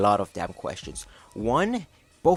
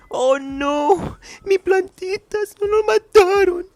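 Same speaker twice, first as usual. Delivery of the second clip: high shrieking cry, way up, much louder, with a mock Spanish accent; wailing over the plants; 10 dB louder than usual. Commentator mocks bully's victim crying over destroyed plants, shrieking in Spanish. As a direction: wail it like a heartbroken grandma over her dead plants.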